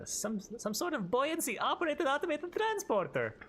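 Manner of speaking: high pompous mock voice showing off a silly invention name; pompous mock announcer. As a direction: puff it up like a fancy gadget name. Jokingly renames the device grandly.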